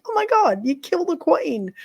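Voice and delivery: high mock-horrified shriek of an animal lover; freaked-out viewer. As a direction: squeal it like a shocked viewer who can't believe it. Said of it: "everybody's freaking out going".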